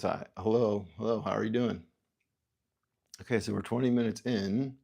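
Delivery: normal talking voice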